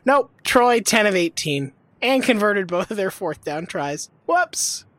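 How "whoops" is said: higher, mocking oops; sarcastic oops. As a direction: toss off a smug whoops. Sarcastic aside after stat.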